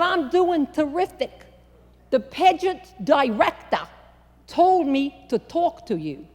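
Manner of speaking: put-on chirpy Long Island girl; Northern city accent. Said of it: "big city Northern accent".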